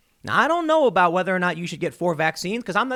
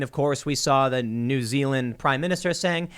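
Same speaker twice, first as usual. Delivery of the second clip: ordinary talk, nothing marked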